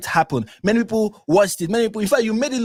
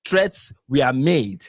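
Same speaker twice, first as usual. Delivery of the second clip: slow quote; 4 semitones lower, much slower than usual. Retold or imitated. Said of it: "the next thing she said was".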